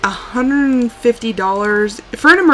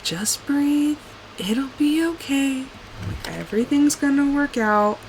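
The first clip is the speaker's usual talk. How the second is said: self-soothing; 5 semitones higher, 7 dB quieter than usual. Retold or imitated. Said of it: "I just keep telling myself".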